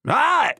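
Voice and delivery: high sudden gasp; short pained intake of breath; sharp gasp of pain. Acted. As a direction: gasp as the stitches tear free. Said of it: "I gasped".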